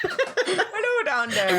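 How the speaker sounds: high mock Scottish cry, laughing; hammed-up Scottish child, voice leaping up then dropping; Sean's mock Scottish accent. Repeating the film boy's greeting, mock Scottish accent. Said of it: "Your accent is better than Sean's".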